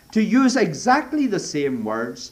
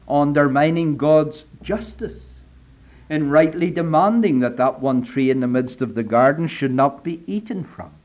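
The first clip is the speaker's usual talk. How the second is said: ordinary delivery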